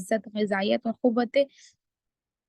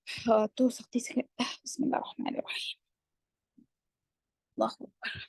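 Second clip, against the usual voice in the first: muttered aside; 7 dB quieter than usual. Real, not acted. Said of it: "aside murmured off-mic".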